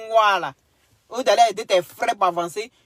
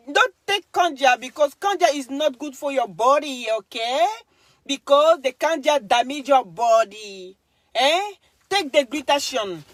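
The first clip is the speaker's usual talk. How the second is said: higher, louder mocking English voice; lightly put-on American scold, taunting; lightly voiced American lecturing Debo; mock American persona; 5 semitones higher, 3 dB louder, slower than usual. Mock English-speaking persona rant.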